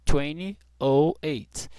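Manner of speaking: slow, careful "twenty oh eight"; slow model. Shown as a demonstration. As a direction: say "oh" slowly like the letter. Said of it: "Posso dividir e falar o vinte".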